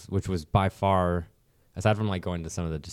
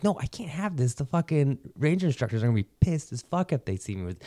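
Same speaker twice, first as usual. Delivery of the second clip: anxious retold protest; 8 semitones higher than usual. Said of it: "I'm like".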